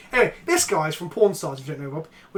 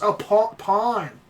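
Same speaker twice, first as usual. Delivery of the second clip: normal talking voice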